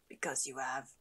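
low voiceless whisper; whispered character. Acted. Voicing a game character's reply.

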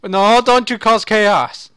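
loud slow warning. Real, not acted. Pleading with dino chaos.

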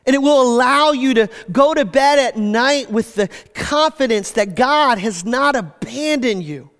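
normal talking voice

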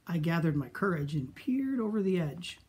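plain reading voice

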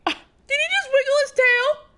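very high squeal of delight. Real, not acted. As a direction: squeal at something cute. Squealing at cute goose.

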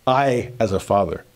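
normal talking voice

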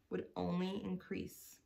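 ordinary delivery, nothing marked

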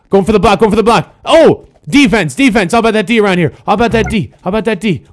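high, urgent court chatter; excited play calling. Real, not acted. Player calling defense mid-game, excited. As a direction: hype up the defense.

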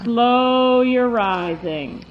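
stretched slow riding cue; slow drawn-out command. Real, not acted. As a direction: draw the words out slowly. Riding lesson, instructor calling commands.

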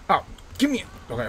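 normal talking voice

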